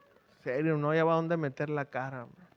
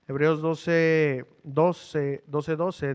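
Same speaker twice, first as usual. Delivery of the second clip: slow emphatic citing; 4 dB louder, much slower than usual. Real, not acted. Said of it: "slow reference reading".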